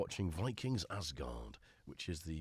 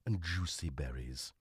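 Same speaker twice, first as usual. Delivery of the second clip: low, grand product-blurb read; advert voice-over; 3 semitones lower, 4 dB louder than usual. Pretend. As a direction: read the packet like a dramatic advert.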